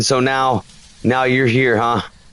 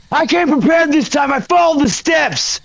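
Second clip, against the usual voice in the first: raised defiant voice; 9 semitones higher than usual. Real, not acted. Boasting to the ghost.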